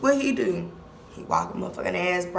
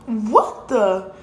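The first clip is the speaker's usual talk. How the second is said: louder, cut-off startled question; jolted awake and confused; startled cousin; 3 semitones higher, 5 dB louder, slower than usual. Retold or imitated.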